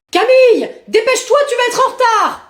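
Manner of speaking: high, impatient mother's call; calling a child. Acted. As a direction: call up to a dawdling daughter. Mother calls child for school.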